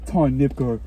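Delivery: usual speaking voice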